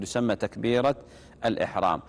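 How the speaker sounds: ordinary talk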